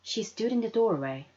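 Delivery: plain reading voice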